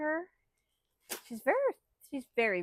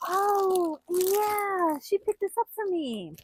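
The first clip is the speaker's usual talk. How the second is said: much higher and louder, thrilled; excited shout; 8 semitones higher, 7 dB louder, slower than usual. Real, not acted. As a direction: burst out with happy excitement. Excited about gift.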